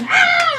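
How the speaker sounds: a sudden terrified high scream; high-pitched scream. Retold or imitated. A kid hears a scream and hams a shrill shriek.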